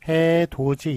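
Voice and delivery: 해돋이 sounded out as "해도지"; palatalized pronunciation. Shown as a demonstration. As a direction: say 해돋이 as "hae-do-ji". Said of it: "이렇게 발음 나죠".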